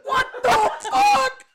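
very high, loud, drawn-out shriek; horrified shriek. Retold or imitated. Dad's horror at rats in freezer, high drawn shriek. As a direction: stretch each word in horror. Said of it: "He's like".

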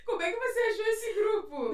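high, loud, through laughter; laughing question. Real, not acted. Host asks how she found the group, laughing hard. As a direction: ask while cracking up.

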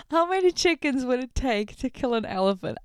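stunned, mocking repeat of the question; incredulous reread. Shown as a demonstration. Vlogger rereads the silly question in disbelief.

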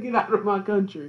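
high, laughing mock quote; quoting friend between laughs. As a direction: crack up while quoting him. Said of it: "he said".

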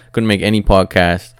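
ordinary delivery

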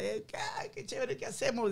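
squeaky excited woman voice; high-pitched wife voice. Acted. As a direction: shriek it like the thrilled wife. Wife reacts to lottery win.

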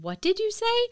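high sing-song mom prompt. Retold or imitated. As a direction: coax the kid to say sorry. Mom prompting child at checkout.

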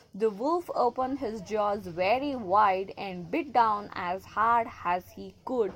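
ordinary delivery

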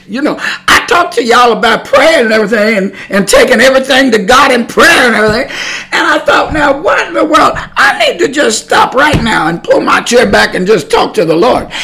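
high, loud, worked-up exclamation; exasperated, preacherly outburst at yourself; loud self-reproach. Retold or imitated. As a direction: raise your voice as if scolding yourself. Scolding themself for not praying first.

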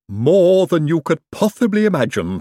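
delighted servant relishing the job; happy Igor. A narrator's reading. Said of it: "said Igor happily".

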